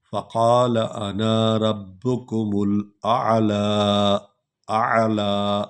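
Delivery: slow, chanted Quran recitation; slow melodic recitation; tajweed recitation. Shown as a demonstration. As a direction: intone each verse slowly, phrase repeated. Continued recitation.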